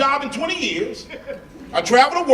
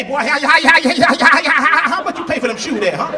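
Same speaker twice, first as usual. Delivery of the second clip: loud stammering country dad; loud stammering father; stuttering dad; 6 semitones higher, 5 dB louder than usual. Retold or imitated. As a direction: sputter the hows at the price.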